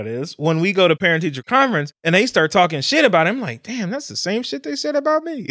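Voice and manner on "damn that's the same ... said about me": high-pitched, quotes his own thought; amused realization. Retold or imitated. Dad hearing his own old school reports, high-pitched amusement.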